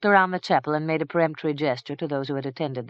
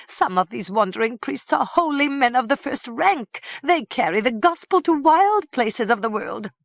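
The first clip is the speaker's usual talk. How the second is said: higher, louder priestly plea; fussy priest pleading; 9 semitones higher, 5 dB louder than usual. A narrator's reading. Priest defends holy wanderers to the king, pleading higher. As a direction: defend the monks earnestly.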